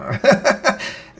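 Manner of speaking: high giggling burst; laugh. Real, not acted. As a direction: giggle at your own failed attempt. Amused by failed voice command.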